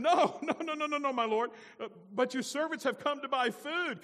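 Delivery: high, loud, flustered denial; hammed-up pleading; pleading protest. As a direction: protest frantically to a powerful lord. Brothers protest to Joseph.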